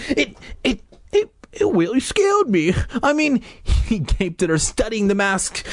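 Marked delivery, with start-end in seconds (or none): 0.1-3.4 high scared little-brother voice; stammering. Acted. Brother admitting the mask scared him, stammering. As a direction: stumble over the first word, high and frightened. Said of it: "Noah stammered".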